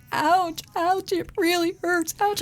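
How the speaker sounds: put-on high whimpering ouch from a hurt boy; child crying ouch. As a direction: mimic a little boy wailing in pain. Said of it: "he's going".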